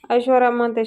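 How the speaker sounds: usual speaking voice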